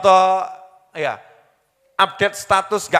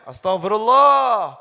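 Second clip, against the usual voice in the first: a drawn-out shout to God; shouted astagfirullah; 2 semitones higher, slower than usual. Retold or imitated. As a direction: cry it out while driving. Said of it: "saya teriak gitu".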